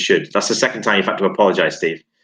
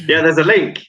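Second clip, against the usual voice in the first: higher, louder, resigned reveal; exasperated announcement; 8 semitones higher, 5 dB louder, faster than usual. Real, not acted. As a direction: announce the slip loudly to everyone. Announces what co-host gave away.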